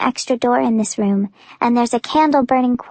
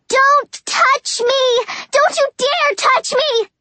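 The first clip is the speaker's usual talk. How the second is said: high, slow, furious don't touch me; furious scream; 14 semitones higher, slower than usual. Acted. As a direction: hurl it at him, pitched way up. Screaming at Warner.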